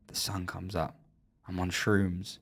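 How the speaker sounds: soft, low and peaceful; hushed awe. Real, not acted. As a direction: drop right down, savouring the calm. Recalling a peaceful sunrise at sea.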